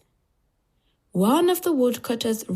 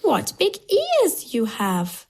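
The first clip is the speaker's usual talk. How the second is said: put-on high, drawn-out child exclamation; wondering little girl, high and slow; 7 semitones higher, slower than usual. A narrator's reading. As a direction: exclaim slowly in a high girl's voice. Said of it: "she says".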